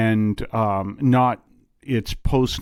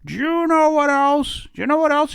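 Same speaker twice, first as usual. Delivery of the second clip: high, squeaky mock voice of a nagging critic; mocking complainer. Mocking radio listeners who complain about the show, squeaky and high. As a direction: ham up a whiny gossip pestering for more. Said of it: "one of those people going".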